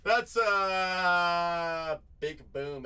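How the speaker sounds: booming hype; hype shout. Acted. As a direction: stretch it out like a stadium call. Celebrates a hit.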